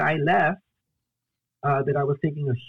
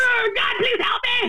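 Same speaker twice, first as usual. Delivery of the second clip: desperate prayer. Retold or imitated. Praying in a mess, crying out to God for help. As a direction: wail it out, begging, half-laughing at yourself. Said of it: "And you just like".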